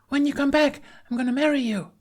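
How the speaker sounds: put-on a small girl calling up to the sky; little girl's call. A narrator's reading. Thura calls a promise after departing Hiccup, girlish high call.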